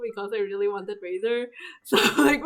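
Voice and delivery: ordinary talk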